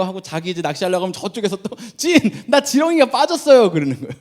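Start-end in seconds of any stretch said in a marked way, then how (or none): 2.0-3.7 loud, high mock plea over a lost worm; pestering camper. Camper calling the host to fix his worm, mock whining. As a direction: whine for help, laughing through it.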